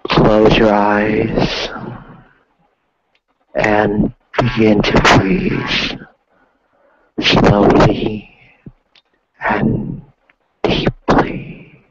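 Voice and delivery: slow, hushed meditation guidance. Acted. Leads a meditation.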